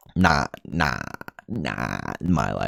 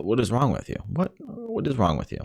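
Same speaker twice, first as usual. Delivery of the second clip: pitched up higher, asking himself in disbelief; self-scolding; 9 semitones higher than usual. Real, not acted. Ranting vlogger scolds himself for stumbling, higher exasperated. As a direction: snap at yourself for tripping over words.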